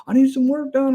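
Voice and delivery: retelling a man hailing his van, high mock voice; imitating a stranger. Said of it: "He goes".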